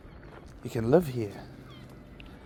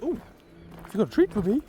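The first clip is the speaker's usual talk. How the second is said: high voiced dog asking for a treat; dog's voice; 10 semitones higher, 4 dB quieter, much faster than usual. Owner voicing his dog begging for a treat, high and sweet. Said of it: "he goes".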